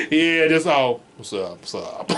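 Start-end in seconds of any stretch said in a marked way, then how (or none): 1.2-2.1 low, soft laid-back voice; relaxed friend mumbling low; chill friend. Retold or imitated. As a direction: drop low and lazy, totally chilling.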